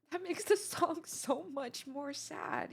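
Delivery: high, slow, tearful laugh; real upset breaking into a laugh, drawn out; barely holding together. Real, not acted. Reacting to the sadder song, barely holding together. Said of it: "trying to keep it together here".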